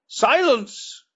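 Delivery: bellowed command, high and loud; roaring. Acted. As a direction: roar it at the council. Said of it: "roared Talhages".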